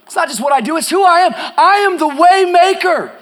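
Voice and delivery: loud, driving sermon climbing to high shouted peaks; full-force preaching. Real, not acted. Preacher proclaiming the cross as the way, full-force fervour. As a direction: proclaim it to a roaring congregation.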